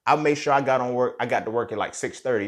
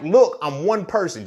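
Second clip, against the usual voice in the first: louder, higher, slow exasperated plea; 8 semitones higher, 7 dB louder, slower than usual. Retold or imitated. Overwhelmed valet telling angry customers he is one person, exasperated and loud. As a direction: snap back at impatient customers.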